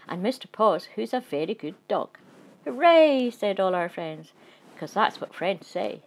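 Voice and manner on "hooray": loud, high cheer; a burst of joy from the crowd; excited hooray. Acted. Friends cheering the wedding.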